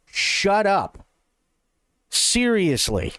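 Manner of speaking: ordinary delivery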